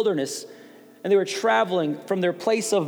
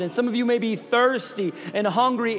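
higher, louder, driving sermon voice; preaching at full force; 5 semitones higher, 4 dB louder than usual. Acted. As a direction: preach it hard to the congregation.

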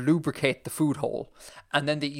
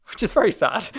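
real laughter; 9 semitones higher than usual. Real, not acted. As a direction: laugh while calling it sad. Amused at their own bleak conclusion.

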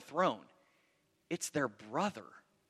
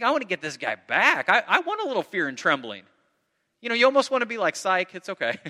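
louder, laughing through the words; laughing mock revenge; 2 semitones higher, 7 dB louder than usual. Preacher admitting he'd want them scared, laughing. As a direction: tease it with a laugh, half joking.

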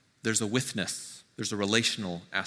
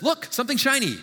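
much higher, louder, bright mock distraction; mock flighty distraction; 10 semitones higher, 5 dB louder, faster than usual. Preacher mocking a distractible god, hammed-up and breezy. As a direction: toss it off like a god waving a shiny toy. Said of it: "Nor is it a God that says".

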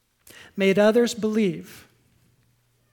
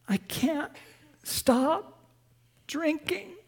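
high, strained, half-voiced plea; a touch of tearful pleading, held back; mild weeping; 7 semitones higher, 4 dB quieter, slower than usual. Kathy confessing at the AA meeting, mildly tearful. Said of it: "She's now weeping".

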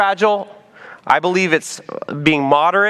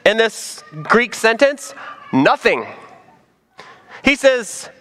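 usual speaking voice

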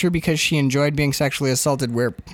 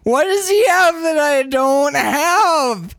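hammed-up drunken wail; 9 semitones higher, slower than usual. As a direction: whine it out like a weepy drunk. Drunk Jack wails at Mary.

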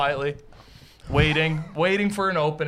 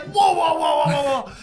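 high, loud string of protest; hammed-up objection, pitched way up; mock yelling; 9 semitones higher, 4 dB louder than usual. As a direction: imitate a friend shouting to interrupt. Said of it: "they're yelling at each other".

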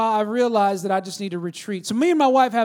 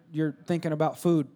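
ordinary delivery

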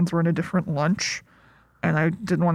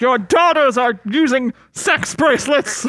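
loud, higher-pitched mock news scare; Fox News alarmist; 5 semitones higher, 4 dB louder than usual. Co-host mocks a scary news report about teens, mock alarm. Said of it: "feels a little bit Fox Newsy".

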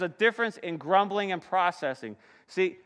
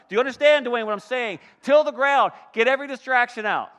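voice of the Lord; 6 semitones higher, 7 dB louder than usual. Acted. Pastor voicing God urging his people on, booming and high. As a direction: call out like God rallying a weary climber. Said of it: "That's what God says to me".

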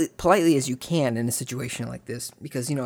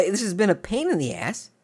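ordinary delivery

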